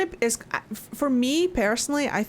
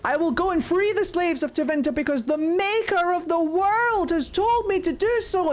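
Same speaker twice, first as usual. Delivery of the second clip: higher, rapturous zealous declaration; hammed-up holy calling; inspired Andraste; 8 semitones higher than usual. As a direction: proclaim like a chosen prophet. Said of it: "And she's like".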